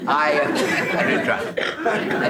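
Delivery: laughing at his own stumble. Real, not acted. Comedian laughs at himself after a fumbled line. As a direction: giggle through the words. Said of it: "I did try to say that quickly".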